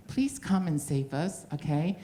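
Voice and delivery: high, polite plea; saying it nicely. Real, not acted. Comic asking a Western audience to save Taiwan, nice high-pitched plea. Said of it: "I'm saying this nicely".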